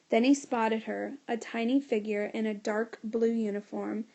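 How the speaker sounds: plain reading voice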